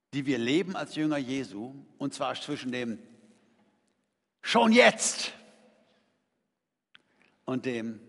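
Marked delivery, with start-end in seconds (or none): 4.4-5.3 schon jetzt said loud and high; emphatic. Shown as a demonstration. Preacher contrasting already and not yet, loud high. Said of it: "das Wort heißt".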